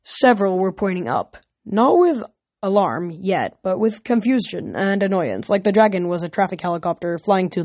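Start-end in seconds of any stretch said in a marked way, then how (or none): none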